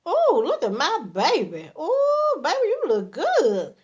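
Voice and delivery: high, swooping coo of admiration; admiring gush. Acted. Man complimenting his dressed-up woman, admiring gush. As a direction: gush over your sweetheart.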